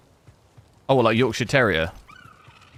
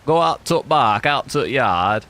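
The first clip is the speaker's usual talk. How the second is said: a put-on Yorkshire accent, dragging out the words; Yorkshire accent attempt; slower than usual. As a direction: try a northern Yorkshire voice. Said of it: "That's not really Yorkshire though".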